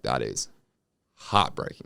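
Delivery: low, hushed and heartbroken; sad. Retold or imitated. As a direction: let it sink in, saddened. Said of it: "you're like".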